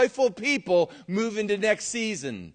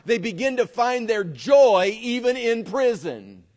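raised, fervent preaching voice; 4 semitones higher, 4 dB louder than usual. Acted. Preacher rousing the congregation, fervent and raised. Preacher building to a high point.

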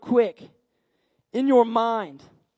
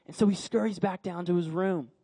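ordinary talk, nothing marked

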